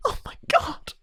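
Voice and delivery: high, voiceless oh my god; breathless disbelief. Real, not acted. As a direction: gasp it out with no voice. Reacts to the long fight.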